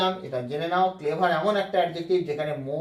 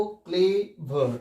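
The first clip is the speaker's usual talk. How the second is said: Clever drawn out in two parts, "cle" then "ver"; slow syllable-by-syllable; much slower than usual. Shown as a demonstration. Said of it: "আস্তে আস্তে যদি উচ্চারণ করি".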